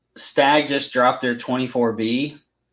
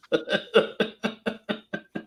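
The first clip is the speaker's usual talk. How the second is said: higher, breathy chuckling; laugh; 7 semitones higher, 6 dB quieter than usual. Real, not acted. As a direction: laugh in a long run after teasing. Laughing after jokingly blaming friends.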